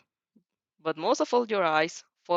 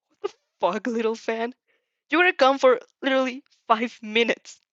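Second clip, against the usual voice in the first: a touch of angry worry, louder at the end; mild anger; 4 semitones higher, 5 dB louder than usual. Acted. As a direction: scold with mild rising alarm. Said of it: "eyes darken with the rage and concern".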